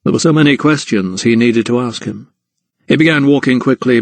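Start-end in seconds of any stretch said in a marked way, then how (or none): none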